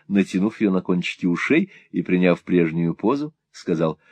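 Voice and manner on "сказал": ordinary delivery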